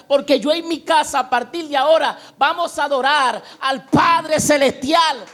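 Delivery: high, loud exhortation building to a roar; fervent preaching. Real, not acted. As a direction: preach at full force, stretching the final words. (predicación ferviente a toda voz)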